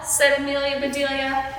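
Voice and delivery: usual speaking voice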